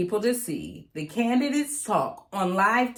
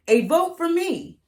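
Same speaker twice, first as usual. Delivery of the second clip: candidate's campaign pitch; 9 semitones higher than usual. Acted. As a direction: pitch it like a hopeful candidate on the stump. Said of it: "the candidates say".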